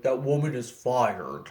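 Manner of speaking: a hammed-up tough guy; mock manly voice. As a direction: puff up and sound as manly as you can. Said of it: "really drove home how manly I was".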